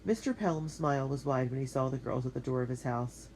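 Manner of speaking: ordinary delivery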